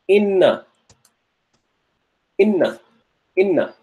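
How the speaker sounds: inna with a long doubled n; teaching inna. Shown as a demonstration. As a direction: say inna, holding the nn. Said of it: "Have you heard the word inna?".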